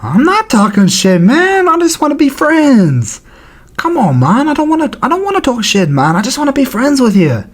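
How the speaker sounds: high-pitched, pleading nice-guy impression; Whitaker. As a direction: plead for peace in a mock whine. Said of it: "Whitaker's like".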